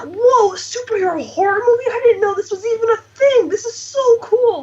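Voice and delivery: high-pitched, gushing astonishment; amazed fans. Retold or imitated. Fans reacting to the New Mutants trailer, amazed.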